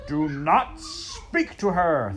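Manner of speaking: slow, loud, high thundering; a furious, booming king. Acted. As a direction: thunder the order at the mouse. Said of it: "thundered the king".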